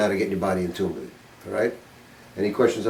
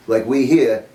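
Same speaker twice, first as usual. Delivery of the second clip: ordinary talk, nothing marked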